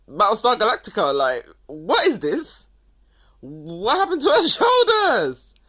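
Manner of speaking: high, very loud, laughing disbelief; loud, cracking up in outraged amazement; incredulous outburst. Real, not acted. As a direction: shriek it through bursts of real laughter. Appalled laughing reaction to an outfit.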